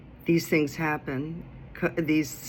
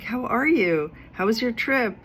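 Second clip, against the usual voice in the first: higher, eager catching-up; eager questions; 7 semitones higher, 3 dB louder than usual. Retold or imitated. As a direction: ask like a friend thrilled to see you.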